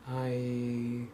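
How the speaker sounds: normal talking voice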